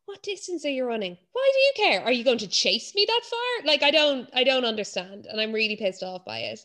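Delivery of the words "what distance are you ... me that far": mock nosy runner. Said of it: "And it's like".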